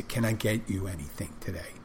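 soft, gentle server question; kind voice. Shown as a demonstration. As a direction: ease it out softly for someone sad. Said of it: "I be as kind in my voice and say".

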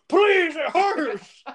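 high, loud, drawn-out whine; mock pained whine. Joe begging about his cut hand, mock pained whine. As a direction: imitate pitiful pleading in pain, slowly.

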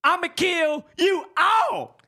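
high loud, very slow shouted threat; Samuel Jackson. Shown as a demonstration. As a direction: shout it like a movie badass. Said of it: "That was Samuel Jackson".

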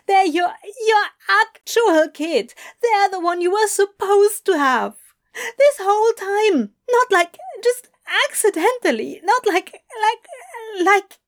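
high-pitched, stumbling outburst; choked-up distress. Acted. Adam stammering at Crowley, choked up. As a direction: struggle to get it out, high and strained. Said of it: "through a wall of resistance in his throat".

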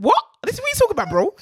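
loud, high, indignant "what?" at the man; half-acted outrage, loud and high; retold angry yell. Retelling confronting the man.

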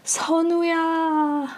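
plaintive reenacted lament; imitated anguished cry. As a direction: retell a father's sorrowful cry, voice held high. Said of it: "the doctor cries out".

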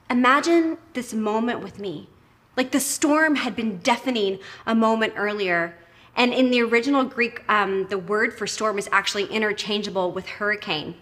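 ordinary talk

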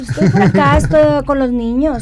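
put-on Sinaloa accent. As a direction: stretch it out like a Sinaloan wife. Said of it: "¿Y tú qué decías?".

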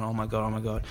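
low-key, quiet retold fretting; fretting under the breath. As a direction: worry softly to yourself. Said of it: "I'm sitting there fretting".

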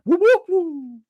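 high, loud imitated siren; police siren whoops. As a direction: whoop like a cop car pulling up.

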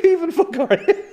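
high, loud laughing quote; sleeping man's imagined retort, high laughing. Retold or imitated. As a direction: giggle through the imagined retort.

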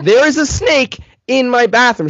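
high, loud, emphatic repeat; acted-out insistent alarm; exasperated insistence. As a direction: spell it out in frantic frustration.

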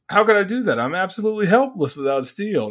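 high, sarcastic helpless act; mocking high voice. Quoting sarcastic sorceress.

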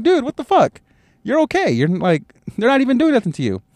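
very high, squeaky mock disbelief; incredulous. Dad to his screaming son over an alcohol pad, incredulous. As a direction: protest in a high, exasperated voice.

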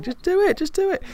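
high, pushy boss voice; urging voice. Retold or imitated. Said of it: "And he was just like".

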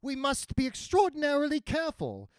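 high-pitched, cautioning nun; Murta's character voice. A narrator's reading. Mercenary nun cautioning her companions, high voice. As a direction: warn them in a high voice.

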